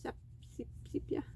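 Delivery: "sepia" sounded out in fragments, very softly, ending SEEP-ya; struggling to pronounce. Real, not acted. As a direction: stumble under your breath through "sepia", landing on SEEP-ya. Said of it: "I can't pronounce anything".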